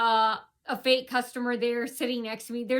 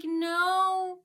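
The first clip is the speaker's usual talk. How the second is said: high, drawn-out retort; retold defiant no; 8 semitones higher, 3 dB louder than usual. Car owner retelling the answer to the officer, defiant. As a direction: push back with a raised, stretched no. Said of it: "I'm like".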